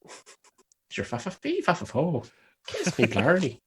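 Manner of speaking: high-pitched mock Irish babble. Friend teasing the Irish cohost, mock Irish. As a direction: tease with a silly high Irish voice. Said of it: "Ooh, he's Irish".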